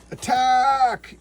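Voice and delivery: shouted high and loud; hammed-up attack shout; mock battle cry. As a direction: yell the charge like a comic hero. Acting out a comic battle cry.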